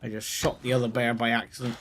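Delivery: ordinary talk, nothing marked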